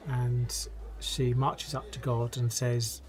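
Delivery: ordinary talk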